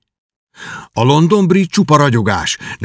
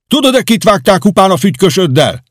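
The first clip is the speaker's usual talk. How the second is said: higher, louder threatening voice through a door; calling out; 8 semitones higher, 4 dB louder than usual. Acted. A man at the door warns Kenty, calling loud. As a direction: menace him from outside.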